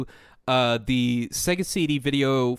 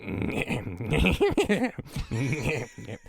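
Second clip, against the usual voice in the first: Beavis laugh; 5 semitones higher than usual. Pretend. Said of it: "let me try it again right now".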